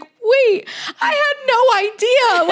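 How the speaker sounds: high, loud, near-crying plea; panicked cry. Retold or imitated. Girlfriend cries out as boyfriend lunges at guy, panicked. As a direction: cry out in alarm as if caught off guard.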